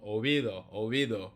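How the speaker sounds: oído with a soft v sound, lips not touching; light Spanish oído; oído, lips close but apart. Shown as a demonstration. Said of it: "they don't actually touch their lips".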